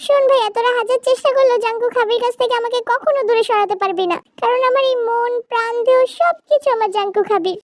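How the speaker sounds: high-pitched devoted little Zara; defiant child wife. Acted. Zara hugging her husband, warning off a rival, high and defiant. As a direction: declare love in a high, insistent voice.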